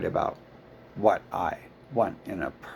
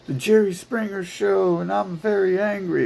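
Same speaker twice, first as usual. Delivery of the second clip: mocking petty complainer; 10 semitones higher, 5 dB louder than usual. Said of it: "it's always like".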